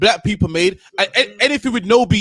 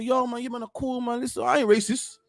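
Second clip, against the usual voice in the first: a hint of Jamaican patois, pitched up; mock patois; 4 semitones higher than usual. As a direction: lightly put on Jamaican patois slang. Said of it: "Put a couple patois in there".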